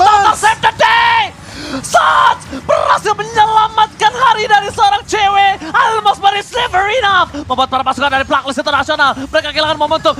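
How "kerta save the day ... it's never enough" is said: hype shout. Real, not acted. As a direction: yell the big save to the crowd. Caster hypes a clutch save. (teriakan caster yang heboh)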